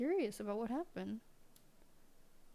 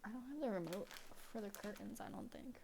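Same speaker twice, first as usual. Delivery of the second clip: much softer, near a whisper; hushed; 13 dB quieter than usual. Real, not acted. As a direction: keep it hushed so the cat stays calm. Hushed so not to scare cat.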